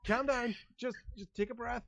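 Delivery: high-pitched panicky pleading; freaking out. Acted. Guard trying to settle massive orc, panicked. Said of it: "and he's freaking out".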